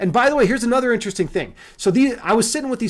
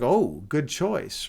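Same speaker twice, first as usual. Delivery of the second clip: slow, low, drawn-out approval; softly impressed, buttering up the customer; fawning flattery; 6 semitones lower, 5 dB quieter, much slower than usual. Retold or imitated. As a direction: imitate a server sucking up. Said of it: "very flatteringly".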